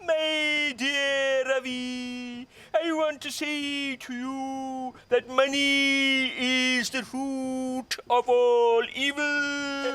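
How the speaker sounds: very slow, high preacher impression; Desmond Tutu. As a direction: draw each word out like a gentle archbishop. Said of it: "he'll say".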